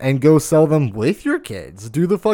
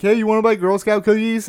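higher, brisk sales call; mom selling cookies; 8 semitones higher than usual. Retold or imitated. Mom at the grocery store calling to shoppers, brisk and high. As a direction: call out to passers-by like a mom at a stand. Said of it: "moms who sit there".

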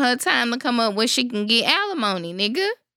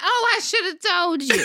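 laughing; 7 semitones higher than usual. Retold or imitated. Voicing wife, laughing.

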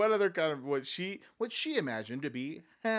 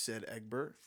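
usual speaking voice

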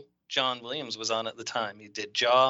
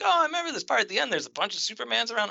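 imitated excited chatter, high and quick; excited retelling; 8 semitones higher, much faster than usual. Eager woman recalling a movie scene, mock-excited. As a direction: rattle off her memory in a higher voice.

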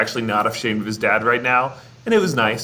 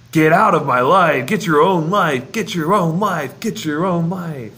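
mock looping recording; 4 semitones higher, 3 dB louder than usual. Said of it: "disco recording on repeat".

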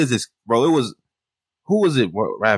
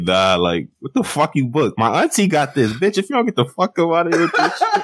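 imitating an outraged rant, higher; hammed-up complaint at the partner; mild mock fury; 6 semitones higher than usual. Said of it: "I'm like".

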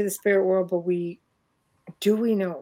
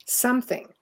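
normal talking voice

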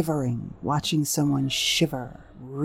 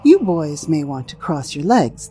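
usual speaking voice

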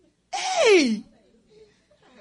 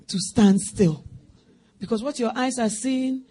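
usual speaking voice

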